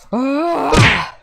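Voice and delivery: pained yelp. Acted. Hit by Alex.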